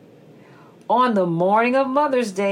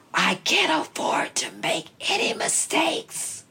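whispering; 8 semitones higher, 4 dB quieter than usual. Acted. Beaver keeps whispering.

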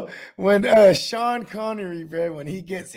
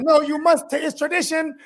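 insisting host; 8 semitones higher, 5 dB louder than usual. Retold or imitated. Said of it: "He's like".

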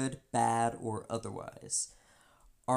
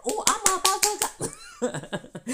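much higher and louder, slow; fired up; 9 dB louder, slower than usual. Retold or imitated. Ready to confront the friend, loud and high. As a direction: rise up ready to fight. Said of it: "I'm just like".

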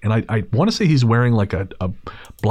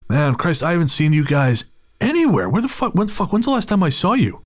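higher, chummy put-on voice, building in pitch; wiry stranger; 5 semitones higher than usual. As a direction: imitate an over-familiar stranger racking his memory. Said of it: "And he goes".

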